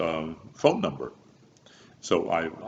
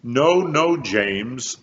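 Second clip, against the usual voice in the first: mock director, louder and higher; sharp correction, raised voice; director correcting; 7 semitones higher, 8 dB louder, much slower than usual. Director stopping James in rehearsal, raised voice. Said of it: "the director saying".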